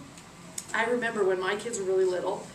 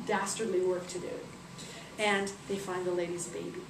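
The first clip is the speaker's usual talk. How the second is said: usual speaking voice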